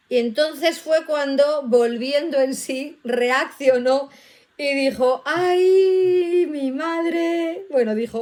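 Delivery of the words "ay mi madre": high, loud, drawn-out exclamation; mock alarmed wail. Teacher acting out Gideon's shock to the group, mock alarmed wail. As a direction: wail it out in comic dismay. Jokey version of Gideon's reaction.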